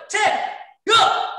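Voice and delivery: high, loud shouted ten; yelled count. Real, not acted. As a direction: shout it out to the class. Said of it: "we're yelling".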